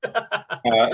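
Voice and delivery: usual speaking voice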